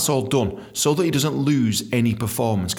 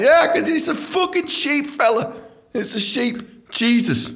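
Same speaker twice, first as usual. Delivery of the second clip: exasperated mockery; 14 semitones higher than usual. Real, not acted. Mocking the dumb owner.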